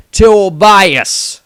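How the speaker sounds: loud, high furious bellow at his son; father's outraged shout; angry shout. A narrator's reading. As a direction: roar the name like an enraged father. Jeremiah scolding his son.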